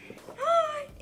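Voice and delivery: high, hammed-up gasp of delight; mock gasp. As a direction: gasp as if thrilled by a gift.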